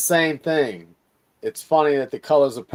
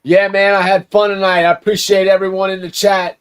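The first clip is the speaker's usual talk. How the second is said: hyped sign-off; 4 semitones higher, 9 dB louder than usual. Real, not acted. Exuberant sign-off to chat.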